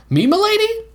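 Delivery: put-on very high boyish question; boy's high rising query; Egg. A narrator's reading. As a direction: squeak it like an innocent boy. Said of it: "Egg replied".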